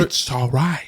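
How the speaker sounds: a light hint of Jim Carrey; playful Carrey-style line; Jim Carrey impression. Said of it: "that's how Jim Carrey talks".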